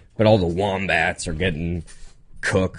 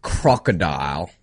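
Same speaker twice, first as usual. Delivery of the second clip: put-on slow, drawn-out Aussie accent; mock Australian accent; 5 semitones lower, slower than usual. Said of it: "Nick is the king of accents".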